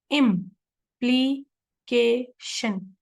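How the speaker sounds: Implication split slowly into four separate beats, im-pli-ke-shan; syllable by syllable. Shown as a demonstration. Teacher counting out the vowel sounds for students, implication broken syllable by syllable. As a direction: say implication very slowly with a clear pause after each syllable. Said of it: "उसको हम to syllables कहते हैं".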